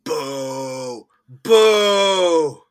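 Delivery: booing, rising to a loud boo; mock booing. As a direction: jeer like an unhappy crowd. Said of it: "I was like".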